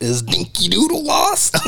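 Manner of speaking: high mocking taunt; bully's voice. Bar bully taunting the detective, high mocking voice. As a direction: sneer it up high like a toon bully. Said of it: "he has, like, a distinct voice".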